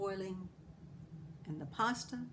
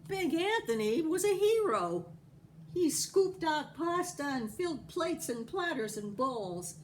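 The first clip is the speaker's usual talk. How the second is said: excited; 8 semitones higher, 4 dB louder than usual. A narrator's reading. Excited reading.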